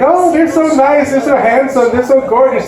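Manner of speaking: gushing praise. Retold or imitated. Relatives fawning over his siblings in a mock gushing voice. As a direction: coo over the pretty siblings.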